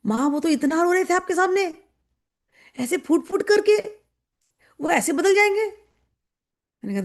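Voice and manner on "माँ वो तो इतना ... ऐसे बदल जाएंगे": higher, wondering disciple's question; puzzled disciple. Retold or imitated. Disciple asking the guru, puzzled and higher. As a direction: ask it like an amazed young follower. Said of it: "आजू बाजू वाले जो शिष्य नहीं समझ पाते".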